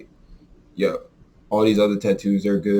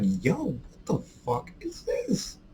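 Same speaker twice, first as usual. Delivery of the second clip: disbelieving; 6 semitones higher, 8 dB quieter, much slower than usual. Retold or imitated. Confronting roommate over bad tattoo.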